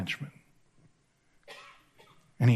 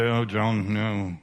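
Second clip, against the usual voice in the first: mocking critics; 9 semitones lower, 4 dB quieter, slower than usual. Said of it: "people say".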